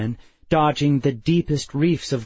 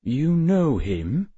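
suspicious; 4 semitones higher, slower than usual. A narrator's reading. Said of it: "Addison said suspiciously".

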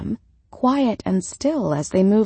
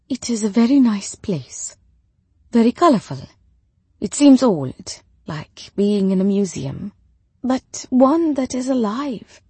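reserved widow; 4 semitones higher, 4 dB louder than usual. A narrator's reading. Widow answers Rebecca about the city, reserved and measured.

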